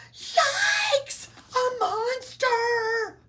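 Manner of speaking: high, loud startled scream; frightened yell. A narrator's reading. Oscar frightened by his reflection, screaming loud and high. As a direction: shriek at your own reflection. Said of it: "Oscar yelled".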